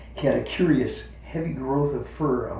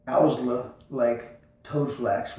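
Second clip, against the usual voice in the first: ordinary delivery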